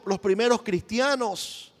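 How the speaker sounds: ordinary delivery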